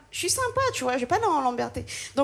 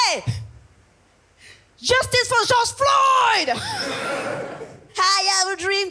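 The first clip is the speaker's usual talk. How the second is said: protest shout; 8 semitones higher, slower than usual. Retold or imitated. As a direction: bellow it like a rally slogan. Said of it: "je fais".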